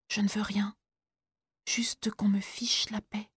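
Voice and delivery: very soft, slow whisper; boy pushing the teacher away in a whisper; weary whisper. Acted. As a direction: breathe the words out, spent. Boy refuses, exhausted.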